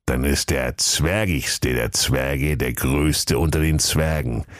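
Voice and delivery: low, slow tortoise explanation; patient old tortoise. Acted. Tortoise explains the riddle to dwarfs, old and slow. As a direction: reason it out slowly as an ancient oracle.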